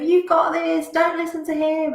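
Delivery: high bright encouraging angel. Retold or imitated. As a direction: cheer them on sweetly. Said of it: "And the angel's going".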